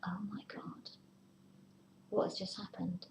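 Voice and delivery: soft, anxious whisper to yourself; worried whisper. Pretend. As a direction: breathe it out like a private fear. Anxious inner voice, left out.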